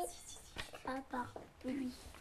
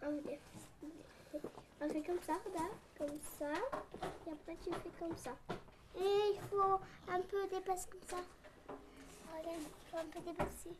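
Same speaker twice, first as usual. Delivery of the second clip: soft, absorbed explaining while writing; quiet explaining; 14 dB quieter than usual. Real, not acted. Child quietly showing a classmate how to write R. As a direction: murmur it as you write.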